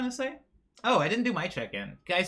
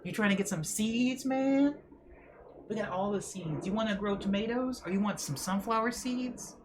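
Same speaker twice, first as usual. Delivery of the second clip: higher, pushy street dealer pitch; hustler seed dealer; 5 semitones higher than usual. Pretend. Streamer imagining friend as a seed dealer, hustler voice. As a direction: sell seeds like a shady corner hustler. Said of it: "I'm imagining Tay doing one of these".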